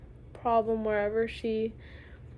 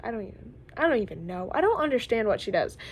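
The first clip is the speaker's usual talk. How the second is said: normal talking voice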